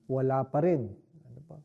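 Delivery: usual speaking voice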